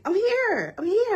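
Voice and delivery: lightly imitated voice of God reassuring; God's voice. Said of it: "and God's like".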